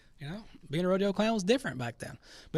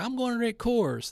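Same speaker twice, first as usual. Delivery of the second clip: higher, whiny complaining voice; mocking angry drinker; 7 semitones higher, 3 dB louder than usual. Mocking boycotters with a higher whiny complaint. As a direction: whine like an offended beer drinker. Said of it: "Then they're like".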